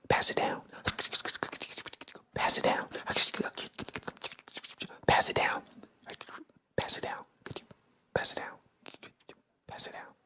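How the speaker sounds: hushed secret handed down a line, dying away; whispering. Shown as a demonstration. Whispering a message along the line in school, fading whisper. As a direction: whisper it, fading fainter with each pass.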